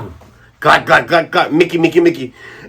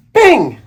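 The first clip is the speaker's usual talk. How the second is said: slingshot ping; 10 semitones higher, 3 dB louder than usual. Retold or imitated. Slingshot sound effect.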